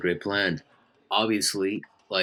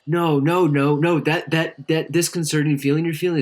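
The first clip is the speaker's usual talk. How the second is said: higher and louder insisting no; emphatic insistence; 8 semitones higher, 5 dB louder than usual. Acted. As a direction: cut him off, insisting it's love. Insistent reassurance in scene.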